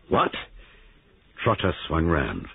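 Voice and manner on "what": sudden alarmed question; startled one-word demand; sharp alarm. A narrator's reading. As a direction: react sharply to bad news. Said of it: "sharp alarm in his voice".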